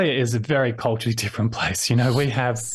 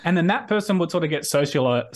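ordinary talk, nothing marked

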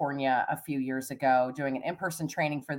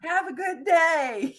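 sheepish high sign-off; 13 semitones higher, 3 dB louder, slower than usual. Retold or imitated. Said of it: "So I was just like".

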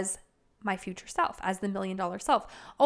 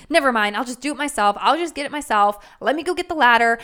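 louder, clipped, fed-up; exasperated huff; 8 dB louder, faster than usual. Retold or imitated. Spouse won't fetch the ladder, fed-up and louder. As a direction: do it yourself in a loud huff. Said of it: "you're like".

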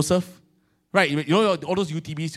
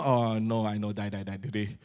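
dreading husband mutter; 8 semitones lower, 7 dB quieter than usual. Retold or imitated. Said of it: "I also know".

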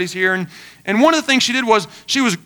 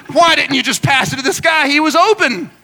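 raised-pitch imitation of an exasperated fan; mock armchair criticism; 4 semitones higher, 3 dB louder than usual. Couch critic complains at a player on the field, mock exasperated. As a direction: gripe at the player as if it were obvious.

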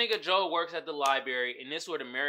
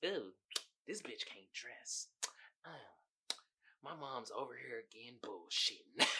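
softer, lower snide muttering; soft catty inner voice; 5 semitones lower, 11 dB quieter than usual. Retold or imitated. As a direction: mutter it like a petty hater. Voicing Love's catty thoughts.

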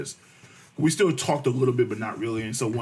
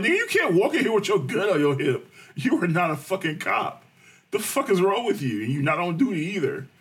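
higher and louder retold scolding; 6 semitones higher, 3 dB louder than usual. Him telling the armed date off at work, mock angry. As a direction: tell her off like she's lost her mind. Scolding her for bringing gun.

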